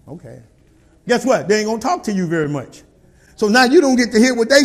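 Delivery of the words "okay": meek mock okay. Mimics a meek reply.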